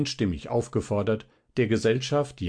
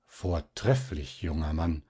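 very earnest; 4 semitones lower, 4 dB quieter than usual. A narrator's reading. Mosch Terpin pressing Zinnober's hand, solemn and earnest. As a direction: weigh each word gravely. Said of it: "sprach sehr ernst".

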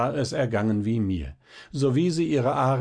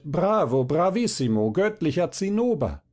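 higher-pitched acclaim, not truly shouted; mock crowd cheer; 6 semitones higher than usual. A narrator's reading. Violinist mimics the hall cheering Zinnober, mock rapture. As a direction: imitate an adoring audience. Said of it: "und schreit".

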